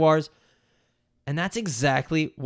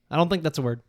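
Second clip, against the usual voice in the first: ordinary delivery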